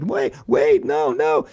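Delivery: high, loud frightened squeal; panicked mouse. A narrator's reading. Mouse pleads with Cat to stop, loud high panic.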